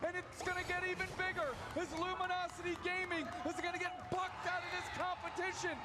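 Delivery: victory call. Real, not acted. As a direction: call the win like a final whistle. Caster calls the decisive victory.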